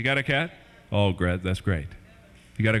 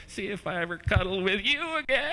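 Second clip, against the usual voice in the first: higher, sobbing pouty whine; sulky cat; 5 semitones higher than usual. Retold or imitated. Offended cat scolding its owner, teary pouting whine. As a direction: whimper a hurt cat's threat. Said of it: "she's like".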